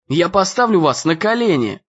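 ordinary delivery

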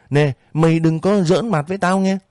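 put-on mean old woman voice, sharp warning; stingy landlady threatening. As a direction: warn him off like a mean landlady. Narrator voices Bảy Vàng threatening.

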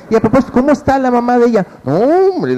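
put-on chatty older lady. A caller acts out the lady who keeps chatting, lightly voiced. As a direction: play the gossiping lady on the phone, lightly.

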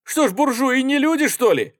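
very high, loud retort; indignant exclamation. A narrator's reading. Artskhanov retorts to the soldier, exclaiming indignantly. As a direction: cry it out in outrage. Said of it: "воскликнул Арцханов".

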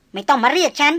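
a lady brushing off the hunter, tart and proud; sharp young noblewoman. Acted. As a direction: snap back as the haughty young lady. Lady rebuffs him.

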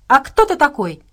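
put-on high, loud haughty child's question. A narrator's reading. Girl demanding who this stranger is, haughty. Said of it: "спросила девочка заносчиво".

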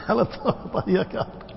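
words breaking up in laughter; laughing. Real, not acted. Teacher amused by old man's verdict, laughing. Amused by his own anecdote.

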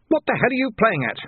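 high-pitched indignant demand; angry challenge. A narrator's reading. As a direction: demand an answer in high outrage. Seized man challenges his captor.

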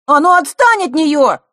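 high, loud angry shout; Лёшка shouting. A narrator's reading. Boy defending the girl from her attacker, shouting. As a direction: yell at him to back off. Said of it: "выкрикнул Лёшка".

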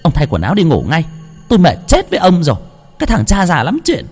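fed-up wife dragging husband out of bed, scolding; angry nagging. Acted. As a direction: snap at him to go to sleep. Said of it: "Bà vợ tức mình".

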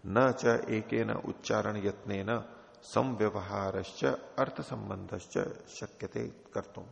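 slow, measured Sanskrit recitation; sutra recitation. Shown as a demonstration. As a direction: intone the sutra slowly, word by word. (धीमा, नपा-तुला सूत्र पाठ)

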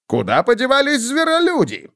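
high, simple-minded dwarf; Snorri's voice. A narrator's reading. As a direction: lift the voice into a childlike dwarf. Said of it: "спросил Снорри".

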